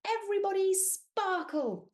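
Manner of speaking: high, loud call; giant's call. Acted. Friendly giant leading, loud call. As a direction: call it out cheerfully. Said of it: "said the giant".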